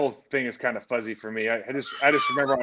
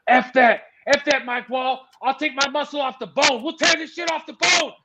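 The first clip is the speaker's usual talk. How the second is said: hammed-up roaring Ray; 10 semitones higher than usual. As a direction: bellow it like a lineman going nuts mid-push. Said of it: "he just starts pushing and going nuts".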